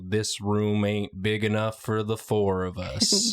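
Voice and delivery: lightly drawled cowboy line, a bit slow; a hint of a Western gunslinger. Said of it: "I talk like a cowboy".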